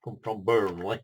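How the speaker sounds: playful Burnley accent imitation. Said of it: "That's the sort of accent that you'll get up there in Burnley".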